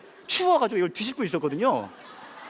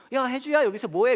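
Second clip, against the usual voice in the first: higher, mildly surprised call; calling out to a child; 7 semitones higher, 3 dB louder than usual. Retold or imitated. A passing woman spots Hyeju, mock surprised call. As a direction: imitate someone spotting a child they know.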